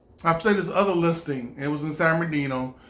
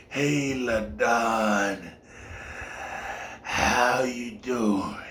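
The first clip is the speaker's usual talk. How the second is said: put-on very slow, low, soft old man's drawl; creepy old man; 7 semitones lower, 4 dB quieter, much slower than usual. The old landlord greeting LaDon, a slow low creepy voice. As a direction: creep out the visitor.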